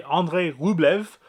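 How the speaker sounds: slow, French-accented "Andrei Rublev". Real, not acted. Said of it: "I said it like a French accent".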